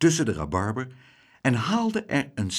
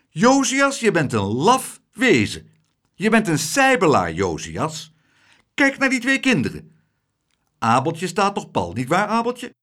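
much higher, louder schoolmistress scolding; scolding old lady; 8 semitones higher, 6 dB louder than usual. Acted. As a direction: shame him as a coward. Klaterhoen scolds Tump.

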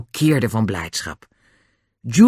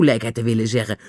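ordinary delivery

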